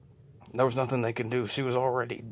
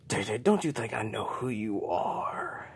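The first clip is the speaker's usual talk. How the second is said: eerie unseen voice whispering a taunt; creepy voice whispering. Acted. Hidden creature taunts J J in an eerie whisper. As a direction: whisper like a lurking monster.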